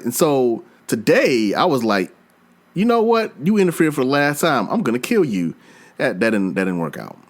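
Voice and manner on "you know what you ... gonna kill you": high start falling into a fed-up vow; mock threat. Player to the monster that keeps interfering, mock-threatening. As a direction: retell a last warning, half in earnest.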